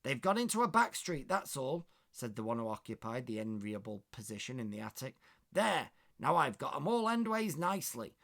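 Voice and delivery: smug West Country maid in the attic. Acted. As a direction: West Country maid bragging about her view. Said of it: "Trying to do a West Country accent there".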